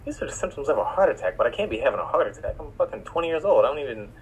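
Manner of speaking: hushed, disbelieving inner voice; quiet retold disbelief. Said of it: "I'm like".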